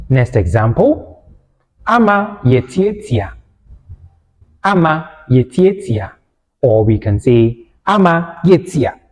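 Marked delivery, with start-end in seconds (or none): none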